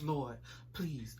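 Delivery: very soft, low plea; retold prayer. Praying after being told to get out of the car, hushed retold plea. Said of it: "all I was doing was".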